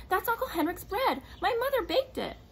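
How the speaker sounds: high, loud indignant protest; girl defends her mother's bread, high and loud; angry. A narrator's reading. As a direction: raise the voice in outrage. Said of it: "she said angrily".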